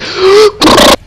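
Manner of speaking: very high startled gasp; shrill gasp. Real, not acted. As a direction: jump and gasp in fright. Startled by game.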